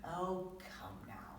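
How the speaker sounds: slow, soft whispered comfort; soothing whisper. Acted. Father soothing upset Tyra, soft slow whisper. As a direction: hush her gently.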